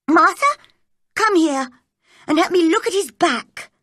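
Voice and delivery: high, loud summons; bossy cry. A narrator's reading. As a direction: call the maid over sharply. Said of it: "cried Mary".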